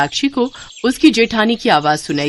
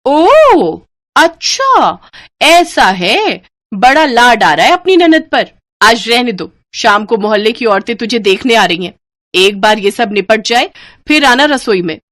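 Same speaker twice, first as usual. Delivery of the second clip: loud sarcastic taunt; 4 semitones higher, 5 dB louder than usual. Acted. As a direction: sneer at her with scorn. Sarcastic taunt at new bride. (ताना मारते हुए ऊँची आवाज़ में)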